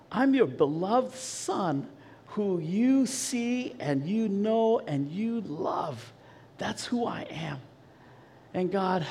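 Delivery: soft, slow, earnest prayer; retold prayer. Patient prays to God in waiting room, soft earnest prayer. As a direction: speak tenderly to God, unhurried. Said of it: "I just started praying".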